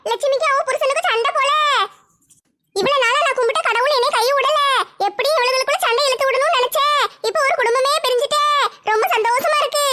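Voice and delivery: scheming neighbour delighting in the quarrel; gloating schemer. Acted. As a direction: savour the family's split like a sly troublemaker.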